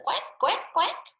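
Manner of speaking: high duck quacking; high playful duck sound; duck quacks. Acted. Duck sounds read aloud.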